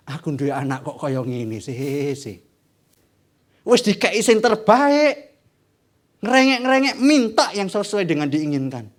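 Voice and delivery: slow, weary exasperation; exasperated God. Acted. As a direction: sigh it out like a tired father.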